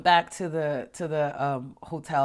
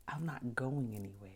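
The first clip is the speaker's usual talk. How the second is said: reassuring whisper; 4 semitones lower, 9 dB quieter than usual. Retold or imitated. Calming herself mid-air.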